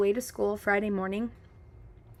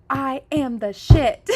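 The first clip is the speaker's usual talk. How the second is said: mock yell; 4 semitones higher, 10 dB louder, slower than usual. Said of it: "I want you to yell".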